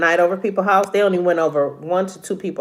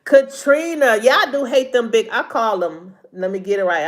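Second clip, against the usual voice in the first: usual speaking voice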